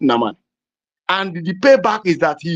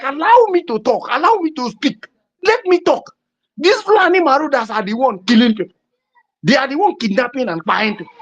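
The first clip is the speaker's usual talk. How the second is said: angry protest; 7 semitones higher than usual. Retold or imitated. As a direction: demand the floor, shouting him down. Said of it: "the man was like".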